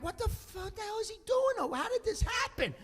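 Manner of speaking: high-pitched, baffled exasperation; exasperated. Retold or imitated. Son sweating over the broken chair, exasperated. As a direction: plead to know how this happened.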